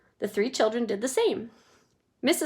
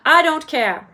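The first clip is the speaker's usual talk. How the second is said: loud, higher bratty shout; 6 semitones higher, 10 dB louder than usual. Acted. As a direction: yell it in a tantrum. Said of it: "shouted Veruca".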